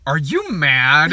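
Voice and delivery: slow, mock-incredulous question; imitating an actor baffled by the plot; Dane DeHaan. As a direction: stretch it out in comic disbelief. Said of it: "him reacting to someone telling him the plot".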